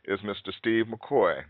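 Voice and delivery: ordinary delivery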